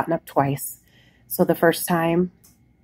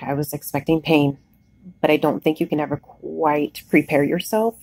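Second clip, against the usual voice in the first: ordinary talk, nothing marked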